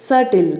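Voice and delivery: సటిల్ with the b left silent; correct pronunciation. Shown as a demonstration. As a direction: say సటిల్ as "SAT-il". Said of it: "అని పలకాలి".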